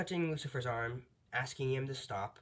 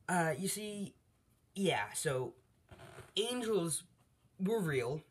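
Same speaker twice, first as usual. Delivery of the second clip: awkward explaining; 6 semitones higher, slower than usual. Retold or imitated. Mael awkwardly explains angels to All Might.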